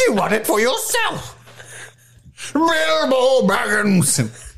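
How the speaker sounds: high, drawn-out mock fury; slow hammed-up angry wizard; angry Gandalf. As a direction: stretch out the name in mock rage. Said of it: "That's angry Gandalf".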